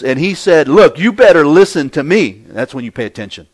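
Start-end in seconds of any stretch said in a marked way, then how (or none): 0.7-2.4 loud, raised-pitch fatherly warning; imitating a father laying down the law; stern dad. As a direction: demand attention like a dad. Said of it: "if my dad was to speak to us".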